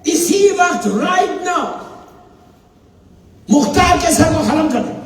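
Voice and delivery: enraged governor yelling in his court; ruler's scream. Retold or imitated. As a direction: bark the execution order across the court. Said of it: "उसने चीख के कहा".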